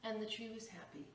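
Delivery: plain reading voice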